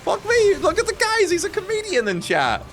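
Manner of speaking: very high squeaky sarcastic voice; mock amazement. Streamer mocking a joker in chat, squeaky mock amazement. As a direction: jeer in a silly falsetto. Sarcastic mockery of a joking chatter.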